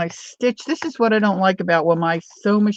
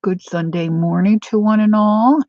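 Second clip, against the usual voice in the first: usual speaking voice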